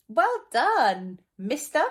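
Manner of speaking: high, loud cheerful praise; bright praise. Real, not acted. Teacher praising a child who read mister, high and loud.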